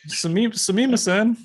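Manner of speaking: a hammed-up peasant mumbling sumimasen; mock clueless tourist. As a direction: play the lost foreigner fumbling an apology. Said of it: "be like".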